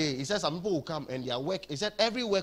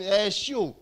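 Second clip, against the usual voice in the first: loud mocking shout; angel shouting; 5 semitones higher, 5 dB louder than usual. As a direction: bellow it like a jeering angel. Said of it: "one angel is shouting".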